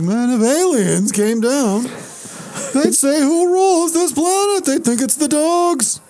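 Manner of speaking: mock Jerry Seinfeld observational rant; Seinfeld impression. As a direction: do the Seinfeld standup cadence. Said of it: "isn't that a Seinfeld thing? He's like".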